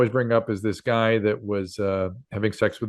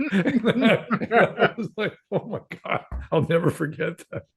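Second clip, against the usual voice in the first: laughing; 11 semitones higher than usual. Real, not acted. Laughing recalling the punchline.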